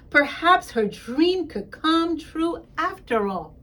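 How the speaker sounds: plain reading voice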